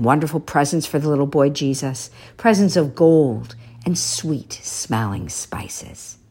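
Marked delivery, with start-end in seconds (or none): none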